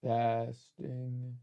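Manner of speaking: testing through a glitchy mic; alien voice. Real, not acted. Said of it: "Sounds like I'm an alien".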